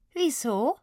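put-on high, puzzled Swabian child; Swabian girl. A narrator's reading. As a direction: ask innocently as a Swabian girl. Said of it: "auf einmal Schwäbisch". (verwundertes schwäbisches Mädchen)

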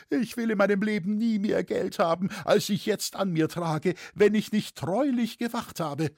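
high, whining lament; plaintive high-pitched pleading; wailing. A narrator's reading. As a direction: protest innocence in a wailing voice. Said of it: "jammerte Hetzer wieder".

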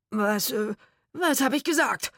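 high, loud, stammered alarm; panicked. A narrator's reading. Man convinced ghosts are real, panicked. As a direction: blurt it in a fright.

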